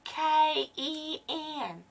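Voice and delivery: high put-on caller voice; squeaky prank-call voice. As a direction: pitch up into a silly fake persona.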